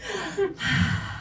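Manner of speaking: long, soft breathy sigh of relief; blissful exhale; relieved sigh. Acted. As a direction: sigh as if finally switched off. Relief after the start-up noise.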